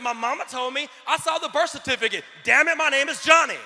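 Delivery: acting out an argument over his own name, ending in a shout; building to a shout. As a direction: insist harder each line until you yell it. Acted quarrel, insisting on his name.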